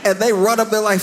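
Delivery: normal talking voice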